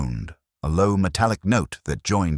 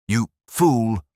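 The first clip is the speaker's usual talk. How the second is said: a higher, louder snarl; angry outburst; 7 semitones higher, 6 dB louder than usual. Acted. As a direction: spit it at him in rage. Keeper curses Holmes.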